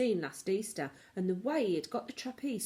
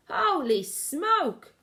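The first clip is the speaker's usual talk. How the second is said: high, loud, slow shocked yell; 8 semitones higher, 6 dB louder, much slower than usual. Acted. Cook feeling the mouse climb, slow loud yell. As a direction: howl it in shock. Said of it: "the man was yelling".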